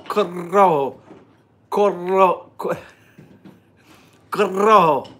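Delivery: straining to trill the r in Corojo; rolled R attempts. Shown as a demonstration. Practicing the band name on stream, trilling Corojo. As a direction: keep trying the rolled r in "Corojo". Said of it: "I gotta make my tongue".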